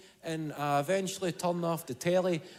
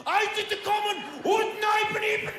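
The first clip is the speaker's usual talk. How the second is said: Hitler rant; 14 semitones higher than usual. Retold or imitated. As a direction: rant like a dictator at a rally.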